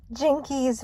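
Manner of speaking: startled exclamation. A narrator's reading. Velma seeing someone behind her, startled exclamation. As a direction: exclaim it high in surprise. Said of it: "Velma exclaimed".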